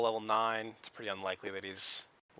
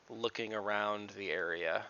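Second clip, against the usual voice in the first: usual speaking voice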